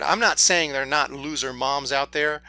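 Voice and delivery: normal talking voice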